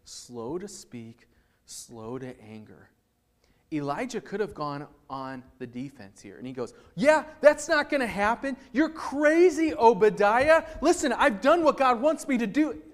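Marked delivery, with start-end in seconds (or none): 0.0-2.9 slow, deliberate reading; slow emphasis. Shown as a demonstration. Preacher reading the verse slowly to the congregation. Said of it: "look at this". 7.0-12.8 loud, high, indignant retort; defensive outburst. Pretend. Elijah snapping back at Obadiah, loud and indignant.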